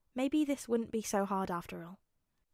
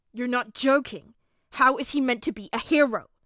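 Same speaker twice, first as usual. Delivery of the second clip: put-on loud, appalled child's outrage; demanding; 3 semitones higher, 11 dB louder than usual. A narrator's reading. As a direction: demand answers, scandalised. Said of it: "Bakugo demanded".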